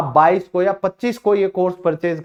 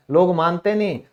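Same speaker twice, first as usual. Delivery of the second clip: ordinary talk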